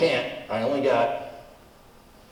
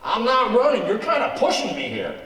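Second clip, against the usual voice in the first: laughing while pushing back, louder and higher at first; laughing protest; 6 semitones higher, 4 dB louder than usual. Acted. Comic cornered by his partner's loan logic, laughing protest. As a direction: crack up as you deny it.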